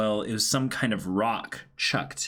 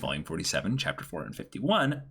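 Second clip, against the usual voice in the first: reading fan letter; 5 semitones higher than usual. Acted. Reads fan letter aloud.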